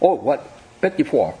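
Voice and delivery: mock-puzzled Petit Four as "peh-tee-FOR"; foreigner's misreading. Shop seller baffled by the order, Petit Four as "peh-tee-for". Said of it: "người ta đọc là Petit Four".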